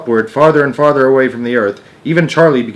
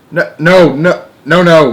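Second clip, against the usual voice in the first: loud, higher repeated refusal; yelled back; 6 semitones higher, 7 dB louder than usual. A narrator's reading. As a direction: shout him down over the noise. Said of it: "Mister Wonka yelled back".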